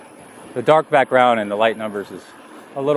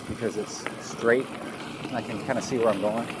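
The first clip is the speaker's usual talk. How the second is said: ordinary talk, nothing marked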